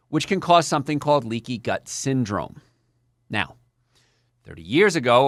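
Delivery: usual speaking voice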